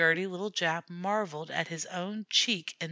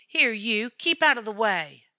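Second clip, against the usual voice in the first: louder, higher gruff order; growl; 4 semitones higher, 6 dB louder than usual. A narrator's reading. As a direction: shove the shabby man aside. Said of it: "growled the director".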